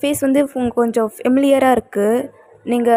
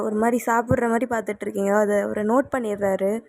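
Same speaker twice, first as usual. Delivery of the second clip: ordinary delivery, nothing marked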